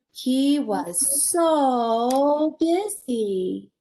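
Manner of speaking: very slow, stretching the word so; slow refrain. Acted. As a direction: draw the refrain out for the kids. Storybook refrain, drawn out.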